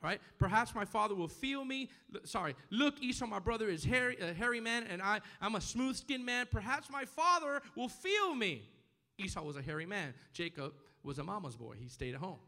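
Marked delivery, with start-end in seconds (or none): 0.4-8.7 higher, emphatic preacher's reading; scripture reading. Acted. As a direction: read Jacob's plea out to the congregation. Reads Jacob's words from scripture.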